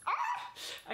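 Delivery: a high, sudden squeal of glee; excited squeal. Real, not acted. Vlogger reveals her eBay bag, squealing. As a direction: yelp with joy.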